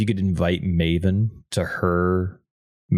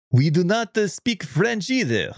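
higher, slow put-on French accent; hammed-up French-accented English; mock French accent; 9 semitones higher than usual. Canadian apologising for bad accents, mock French accent. Jokes about French, then cites Poirot.

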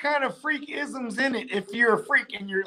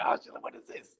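whispered; 13 dB quieter than usual. Retold or imitated. Imagined searcher's shocked reaction.

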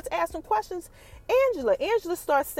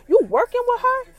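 higher and louder accusing question; angry son; 9 semitones higher, 4 dB louder than usual. Retold or imitated. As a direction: fire it at her in disbelief. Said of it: "he like".

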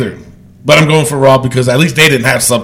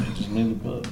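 whisper; 2 semitones lower, 15 dB quieter, faster than usual. Acted. Whispered repeat of the same line.

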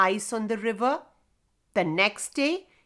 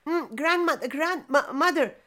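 high, hesitant stammer; nervous little boy faltering; 5 semitones higher than usual. A narrator's reading. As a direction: stumble repeatedly over grandmother. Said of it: "stammered the boy".

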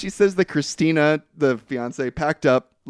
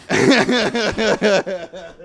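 laughing; 5 semitones higher than usual. Real, not acted. Laughing at the fart joke.

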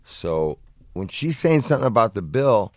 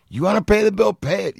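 higher, taunting; mock taunt, pitched up; daring challenge; 10 semitones higher, 4 dB louder than usual. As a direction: dare him to pay. Friend dares the stranger.

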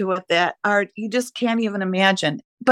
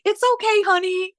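put-on much higher, gentle comforting voice to a child; tender reassurance; 11 semitones higher than usual. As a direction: soothe an embarrassed little pupil. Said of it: "And I'd be like".

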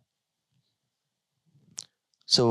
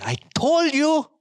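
exasperated insistence; 13 semitones higher, 3 dB louder, slower than usual. Acted. Chicken eagle gives up, insists.

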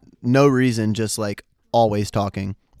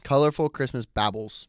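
talking with a mouthguard in; words blurred by a mouthguard; 7 dB quieter than usual. Real, not acted.

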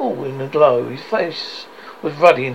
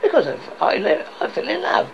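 lightly raised, halting answer; earnest nephew; 6 semitones higher than usual. Acted. Nephew tells Scrooge why he married, mildly earnest. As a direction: explain it with a touch of eagerness.

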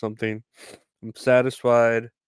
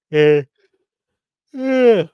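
loud yeah; 7 semitones higher, 11 dB louder than usual. Real, not acted. Loud yeah after confessing debt.

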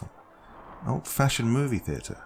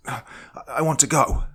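high, loud desperate shout; loud desperate yell at the captor; acted shout; 8 semitones higher, 5 dB louder than usual. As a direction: blurt it out to break free. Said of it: "I shouted".

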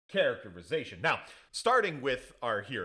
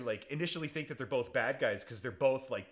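ordinary delivery

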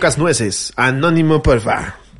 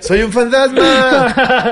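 very slow, higher, drawn-out ghost voice; spooky ghost; 5 semitones higher, much slower than usual. Pretend. Joking that the drunk guy claims to be a ghost, slow and spooky. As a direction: moan it out like a cartoon ghost.

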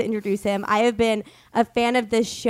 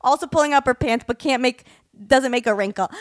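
usual speaking voice

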